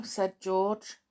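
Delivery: ordinary delivery, nothing marked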